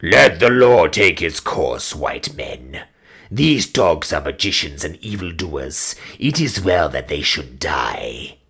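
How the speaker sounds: low, slow and stern command; a cruel king shutting down protest. Acted. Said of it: "sternly repressed by Twala".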